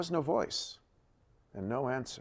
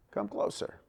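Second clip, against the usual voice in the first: hushed, secret invitation; whisper; 9 semitones higher, 7 dB quieter than usual. A narrator's reading. Elijah beckoning the crowd to the altar, whispered. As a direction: draw them in under your breath.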